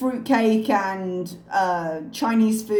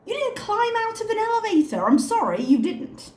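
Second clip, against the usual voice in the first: incredulous scoffing; 7 semitones higher than usual. Real, not acted. Scoffing at Chantel's claim she climbed out, incredulous. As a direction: pitch climbs higher and higher in disbelief. Mocking her elevator escape story.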